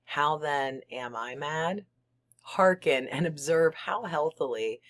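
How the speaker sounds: ordinary delivery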